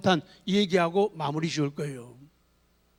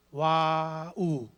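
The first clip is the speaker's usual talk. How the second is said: drawn-out wow; 4 semitones lower, 3 dB quieter, much slower than usual. Shown as a demonstration. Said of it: "drawn-out demo exclamation".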